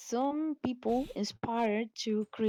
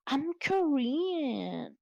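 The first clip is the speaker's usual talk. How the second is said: higher, very drawn-out mocking imitation; mock boastful claim; 7 semitones higher, much slower than usual. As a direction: mimic her claim, stretching it out high. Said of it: "she say".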